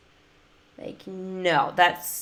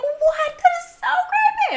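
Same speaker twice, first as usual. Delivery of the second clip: much higher, startled yelp; startled friends. Retold or imitated. Friend caught off guard by the Gollum whisper, yelping. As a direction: jump back and cry out, spooked. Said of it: "they're like".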